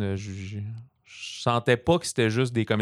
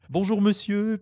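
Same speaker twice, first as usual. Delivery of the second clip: much higher, sing-song mock Bonjour; mock film French; 11 semitones higher, slower than usual. Imitating film characters' token French greeting, mock high. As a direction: chirp it like a token French line in an English film. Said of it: "ils disent".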